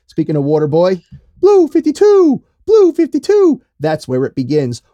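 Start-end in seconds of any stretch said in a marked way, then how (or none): 1.4-3.6 very high, loud, slow goofy voice; Waterboy impression. Quoting The Waterboy for his cohost, very high and loud. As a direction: squeal it like the Waterboy.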